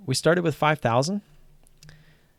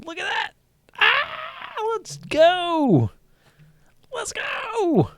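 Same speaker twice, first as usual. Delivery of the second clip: high-pitched triumphant let's go; excited cheer; much slower than usual. Real, not acted. Streamer finding out he's profitable after commissions, cheering.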